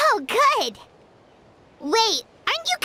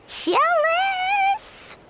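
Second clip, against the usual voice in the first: high, playful jealous whine; mock jealous; 9 semitones higher than usual. Paimon teasing her friend about free fruit. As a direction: pout it out teasingly.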